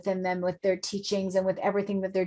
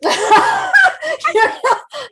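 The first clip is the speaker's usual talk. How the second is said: shrieking burst of laughter, much higher and louder; 9 dB louder than usual. Real, not acted. Host cracking up at the guest's joke, loud shrieking laugh. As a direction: let a big high laugh explode out.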